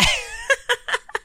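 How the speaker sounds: squealing high giggle. Real, not acted. Host giggling at his own trash talk. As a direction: let a shrill giggle out.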